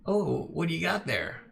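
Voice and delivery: high, curious shady dealer; a fence eyeing the stack of books; boss voice. Acted. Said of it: "He goes".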